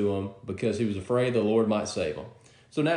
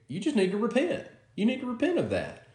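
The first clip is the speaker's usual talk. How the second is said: preaching exhortation; 9 semitones higher, faster than usual. Real, not acted.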